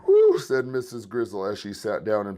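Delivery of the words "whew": big high breathy whew of relief; loud relieved whew. A narrator's reading. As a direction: blow out a tired whew as you sit. Sitter sinks into big chair.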